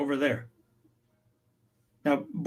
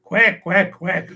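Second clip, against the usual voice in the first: elders teasing the linguist, a playful quack quack; teasing quack, said with a chuckle; mock duck quack; 3 semitones higher, 3 dB louder, slower than usual. Elders joking with each other, a mock quacking. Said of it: "kind of chuckled".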